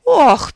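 sharp high loud squeak of pain; squeaked ouch. A narrator's reading. Rabbit squeaking as the wizard grabs his ears. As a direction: yelp as ears are grabbed. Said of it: "пискнул кролик".

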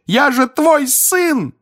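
slow, high, loud pleading shout; desperate cry. Acted. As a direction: beg your father to see you. Son begging father.

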